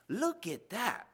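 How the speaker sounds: high, loud, slow exclamation; a startled shepherd calling out; exclaimed. Acted. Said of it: "one shepherd exclaimed".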